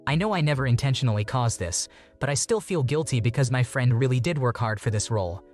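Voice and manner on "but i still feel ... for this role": plain reading voice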